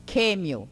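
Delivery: QUÊMIO with a hard initial K; K sound. Shown as a demonstration. Teacher shows the modern Esperanto form, K in QUÊMIO. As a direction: start QUÊMIO on K instead of the throaty sound. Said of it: "tá se substituindo pelo K".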